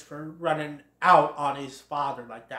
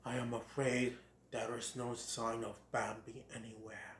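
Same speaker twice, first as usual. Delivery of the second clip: low, very soft, solemn voice; grave Great Prince; 7 semitones lower, 15 dB quieter than usual. Acted. Great Prince reports no sign of Bambi, low grave voice. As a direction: drop it low and hushed, regal.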